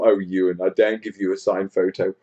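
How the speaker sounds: ordinary talk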